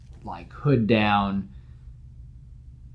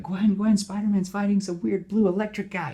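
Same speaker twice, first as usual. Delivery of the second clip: much higher, eager little-brother call; excited kid brother; 11 semitones higher, 4 dB louder than usual. Acted. Younger brother calling Gwen to the TV, excited. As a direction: blurt it out thrilled. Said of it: "her younger brother's like".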